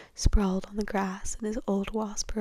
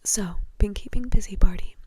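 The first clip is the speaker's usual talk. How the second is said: louder, whispery casual question; breezy; 9 dB louder than usual. A narrator's reading. Bagman chatting to Barty about work, breezily. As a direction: toss it out breezily, with more volume. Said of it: "said Bagman breezily".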